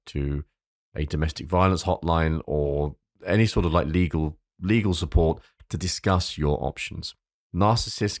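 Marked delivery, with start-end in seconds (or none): none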